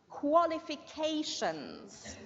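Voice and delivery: slow qualifications starting with a clear kw sound; qualifications, slow, with QUA clear; careful model word. Shown as a demonstration. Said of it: "it's qua".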